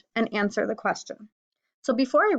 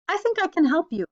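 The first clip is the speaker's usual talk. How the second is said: much higher bird voice; little bird offering; 8 semitones higher than usual. Acted. Bird offering help to the rhinoceros, high eager voice. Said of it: "said the bird".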